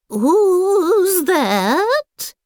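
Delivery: lightly voiced old granny calling; a hint of a creaky old woman. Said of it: "A creaky voice called".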